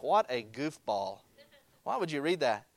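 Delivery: low, soft, slow dismissive scoff; mocking scoff. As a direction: brush it off as silly. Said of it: "You'd be like".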